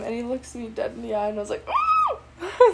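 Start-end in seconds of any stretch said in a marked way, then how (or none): 1.7-2.7 a sudden high laughing shriek; shocked shriek. Real, not acted. Seeing him in person, a squealing laughing shriek. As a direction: burst out in a high startled squeal.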